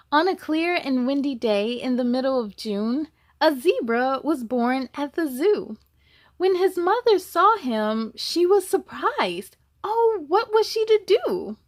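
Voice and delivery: put-on reading to children. A narrator's reading. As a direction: tell it brightly to the little ones. Reads the picture book aloud.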